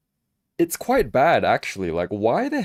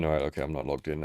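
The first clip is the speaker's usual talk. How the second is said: fast, softer muttered repeat; muttered to himself; 6 semitones lower, 7 dB quieter, much faster than usual. Real, not acted. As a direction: mumble it quickly under your breath.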